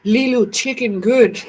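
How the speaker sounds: slow, broken-word Fifth Element caveman voice; caveman talk. Retold or imitated. Vlogger quoting The Fifth Element to viewers, slow mock caveman speech. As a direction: copy Leeloo learning to talk, slow. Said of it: "She talked like a damn caveman".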